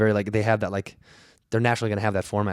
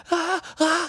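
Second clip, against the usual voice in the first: much higher mock panic scream. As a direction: scream as the doors swing open. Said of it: "and I'm screaming".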